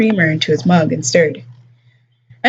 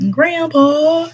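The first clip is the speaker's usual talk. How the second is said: high, whiny "Grandpa" stretched out; mock bratty kid; 9 semitones higher than usual. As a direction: mimic a whining brat. Mock whine repeated.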